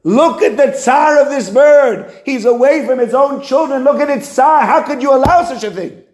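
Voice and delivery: high, slow, imploring plea for the suffering bird; pleading angel. Acted. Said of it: "comes to Hakadosh Baruch Hu and says".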